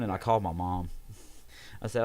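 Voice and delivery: ordinary talk, nothing marked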